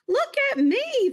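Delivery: put-on girl calling from the waves; girl calling excitedly. A narrator's reading. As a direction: shout it thrilled from the water. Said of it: "the girl called".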